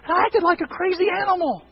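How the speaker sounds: high, loud, burst out like a wild man; mock wild confession. Asaph admitting his madness to God, in a high hammed-up cry.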